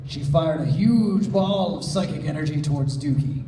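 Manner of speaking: ordinary delivery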